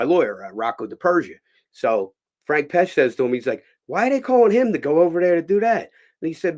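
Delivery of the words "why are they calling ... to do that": loud, high-pitched complaint; incredulous question. Retold or imitated. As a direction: demand it in high, loud disbelief. Old actor puzzled by the call.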